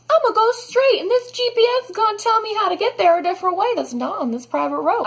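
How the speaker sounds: high, bright, self-assured inner voice; smug past self. Retold or imitated. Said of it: "so I'm like".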